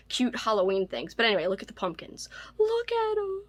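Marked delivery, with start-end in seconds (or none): 2.6-3.4 cutesy coo. Real, not acted. Cooing over cute pumpkin bookmarks.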